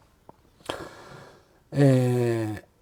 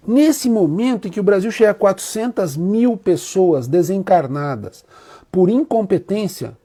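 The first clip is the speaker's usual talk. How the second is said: ordinary talk, nothing marked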